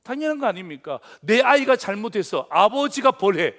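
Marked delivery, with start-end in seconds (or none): 1.2-3.5 emphatic. Real, not acted. Preacher's emphatic example.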